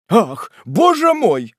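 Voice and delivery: slow, high, loud exclamation; drawn-out cry of dismay; alarmed cry. Acted. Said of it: "вскричал мистер Пиквик, с беспокойством".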